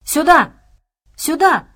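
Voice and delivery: put-on high, loud childlike call; child's voice. A narrator's reading. Child at the doorway beckoning the trapped group, high child's call. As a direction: call out like a child summoning people. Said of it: "прозвучал неожиданно детский голос".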